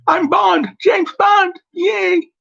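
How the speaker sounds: a botched Bond impression, yelled high with a cheer; bad Bond impression. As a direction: overshoot the Bond line into a silly high yell. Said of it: "you can do the voice".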